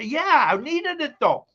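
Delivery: very high mock excuse; sheepish husband. Husband defending the purchase to his wife, high mock sheepish. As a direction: squeak out a guilty excuse.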